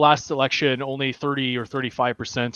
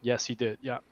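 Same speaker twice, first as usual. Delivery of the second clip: ordinary talk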